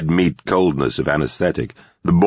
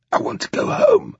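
breathless whisper through clenched teeth. A narrator's reading. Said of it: "she whispered through clenched teeth".

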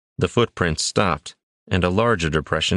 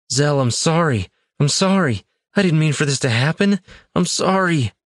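high pleading, grieving voice; anguished apology; 9 semitones higher than usual. A narrator's reading. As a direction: beg forgiveness from a dying friend. Apologizing to dying friend.

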